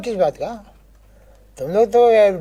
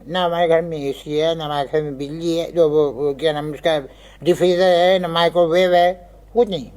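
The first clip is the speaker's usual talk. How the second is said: much lower, subdued grumbling list; 8 semitones lower than usual. Acted. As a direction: drop the pitch and list the grievances. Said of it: "tone नीचे करो". (दबे, नीचे सुर में शिकायत)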